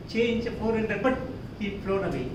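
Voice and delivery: usual speaking voice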